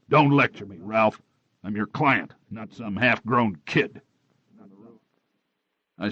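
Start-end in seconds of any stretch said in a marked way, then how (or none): 0.1-4.0 raised, angry voice, last words slowed. Acted. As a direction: push back hard in anger. Angry quarrel with his investigator.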